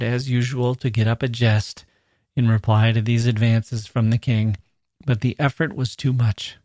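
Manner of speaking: usual speaking voice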